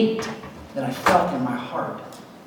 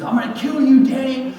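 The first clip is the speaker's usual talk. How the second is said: loud, high furious threat; angry threat; 8 semitones higher, 7 dB louder than usual. Retold or imitated. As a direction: shout it like the bully chasing you. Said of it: "Which means".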